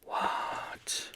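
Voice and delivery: drawn-out, barely audible whisper; hushed, stretched question; whispered disbelief. Pretend. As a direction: whisper it in mock astonishment.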